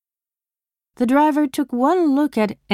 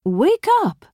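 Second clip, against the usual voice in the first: calling out; 10 semitones higher than usual. Acted. Joe rousing a drunk girl.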